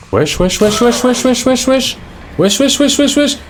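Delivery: frantic, very high-pitched rapid-fire repetition; comic panic. Real, not acted. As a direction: squeal it over and over as you flee. Panicking while fleeing in the game.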